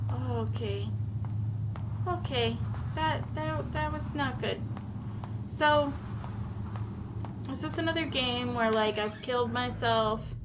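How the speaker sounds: usual speaking voice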